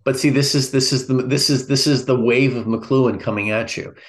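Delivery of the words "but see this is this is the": ordinary talk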